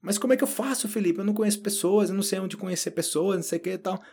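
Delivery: worried learner. Retold or imitated.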